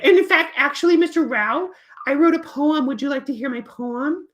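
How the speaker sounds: high, eager puppet voice; Peter. Acted. Puppet offers teacher his poem, high and eager. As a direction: pipe up high and proud. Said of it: "and then Peter says".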